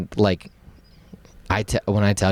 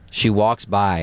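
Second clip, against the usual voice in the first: ordinary talk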